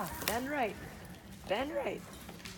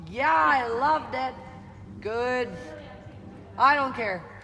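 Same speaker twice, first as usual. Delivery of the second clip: loud delighted praise; excited praise; 3 semitones higher, 7 dB louder, slower than usual. Real, not acted. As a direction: call it out with laughter. Delighted coach after good canter.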